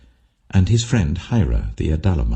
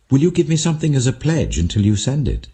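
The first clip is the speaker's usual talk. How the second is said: a touch of a higher, quicker woman's voice; a hint of a younger woman, higher and quick; lightly voiced Tamar; 9 semitones higher, faster than usual. A narrator's reading. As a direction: Tamar pressing him, higher and faster. Tamar voiced demanding a pledge.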